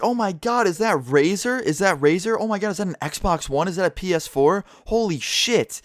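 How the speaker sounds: put-on high, gushing kid voice, pitched well up; excited kids. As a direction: gawk at the gear like a starstruck teen. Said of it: "and they'll be like".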